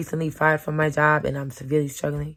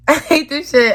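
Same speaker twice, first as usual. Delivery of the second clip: a bitter laugh breaking through the words; 10 semitones higher, 8 dB louder than usual. Real, not acted. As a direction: repeat it laughing, higher and louder. Repeats line with a laugh.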